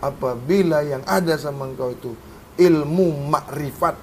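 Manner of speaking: low, soft, measured exposition of doctrine; quiet teaching. Real, not acted. Teacher explaining doctrine to students, low and hushed. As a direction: lay it out softly and steadily, like a creed.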